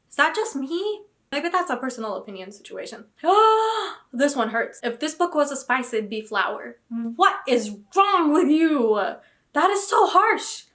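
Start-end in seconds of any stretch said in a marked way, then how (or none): 3.2-4.1 sharp high gasp of shock. Real, not acted. Reacting to a cruel review with a sharp gasp. 6.9-9.0 outraged disbelief. Real, not acted. Reviewer insulted her favourite book, slow outraged question. 9.5-10.6 slow, high and loud, stunned by the insult; outraged disbelief. Real, not acted. Defending her favourite book, slow wounded outcry.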